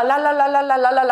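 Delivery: rapid "lalala" tongue babble; imitated tongues. Imitating a child's first tongues, rattled babble. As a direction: rattle off loud repeated la syllables. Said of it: "그날 처음 방언을 받았어요".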